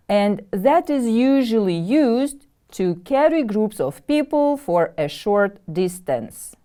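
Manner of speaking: normal talking voice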